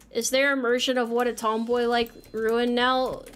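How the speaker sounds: ordinary talk, nothing marked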